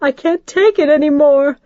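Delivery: high, loud tearful plea; falling apart in tears; sobbing mother at the breaking point; tearful cry. Acted.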